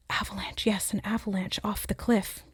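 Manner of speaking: hushed unvoiced whisper of the word avalanche. Real, not acted. Channeler repeating what spirits show her, whispered. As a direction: breathe it out barely audible, as if passing on a secret.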